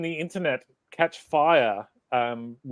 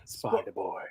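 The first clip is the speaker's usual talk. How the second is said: soft, higher-pitched murmur; breakdown hush; 5 semitones higher, 10 dB quieter than usual. Pretend. Co-host playing the song's quiet breakdown, hushed. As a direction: hush the name like a song breakdown. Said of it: "breakdown section of the song where we bring it down".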